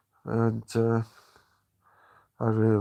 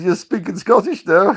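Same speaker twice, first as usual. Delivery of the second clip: put-on Scottish accent; 8 semitones higher, 9 dB louder than usual. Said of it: "I actually took on the Scottish accent".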